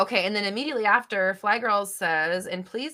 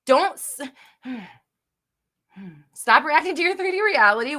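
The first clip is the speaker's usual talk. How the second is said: higher, louder command; louder insistent "stop reacting"; firm scolding; 5 semitones higher, 7 dB louder than usual. Real, not acted. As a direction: scold her straight. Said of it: "big sister wants to yell at you".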